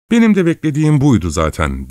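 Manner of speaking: high-pitched defiant exclamation; crying out. A narrator's reading. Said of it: "diye haykırdı Katerina Lvovna".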